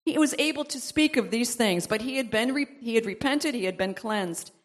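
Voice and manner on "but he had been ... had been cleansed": ordinary delivery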